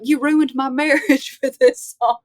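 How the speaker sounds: a light, giggly take on an annoyed fan; imitating an upset man. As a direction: play the wronged husband, half laughing. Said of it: "was like".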